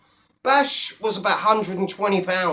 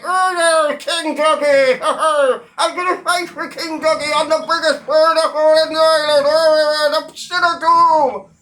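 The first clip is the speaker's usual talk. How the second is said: loud, higher hammed-up braggart; mock boastful shout; 6 semitones higher, 5 dB louder than usual. Mocking the rival as a loud, higher boasting fake fighter. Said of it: "He's an out of shape".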